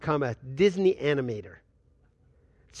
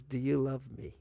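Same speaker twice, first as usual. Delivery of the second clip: soft question; 4 semitones lower, 6 dB quieter than usual. Acted. The Lord asks in the night.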